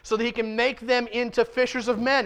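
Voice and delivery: ordinary talk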